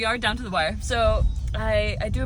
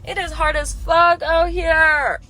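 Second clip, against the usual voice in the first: shouting vent; 4 semitones higher, 7 dB louder than usual. Real, not acted. Venting about producer life.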